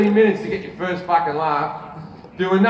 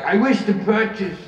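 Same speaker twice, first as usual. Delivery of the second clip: higher-pitched mock elderly customer; really old person; 4 semitones higher than usual. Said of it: "When a really old person comes in".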